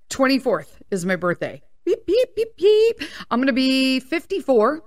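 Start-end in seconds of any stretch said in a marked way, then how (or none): 1.8-3.0 high playful beep beep; mock beeping. Host announcing her birthday, playful high beeps. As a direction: beep like a silly alarm. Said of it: "birthday countdown noise".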